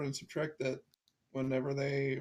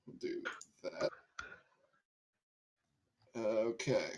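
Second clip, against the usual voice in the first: ordinary talk, nothing marked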